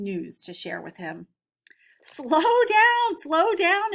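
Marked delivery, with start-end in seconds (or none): 2.0-3.9 much higher and louder grandpa calling out; slow the excited kids down, big and bright; teacher voicing grandfather telling kids to slow down; grandfather advising. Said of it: "advised Samuel".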